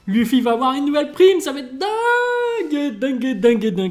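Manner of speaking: squealing high and drawn out, then chanting; bursting with hype over the new bounties; overexcited. Real, not acted. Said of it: "je suis surexcité comme ça".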